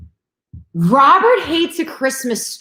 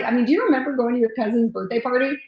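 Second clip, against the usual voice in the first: usual speaking voice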